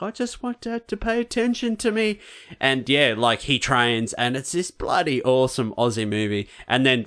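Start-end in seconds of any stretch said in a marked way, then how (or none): 0.0-2.2 put-on much higher, whining kid's voice; whiny younger son. Younger son telling mum he just wants dad's attention, whining. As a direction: plead like a hurt little boy. Son whines about dad.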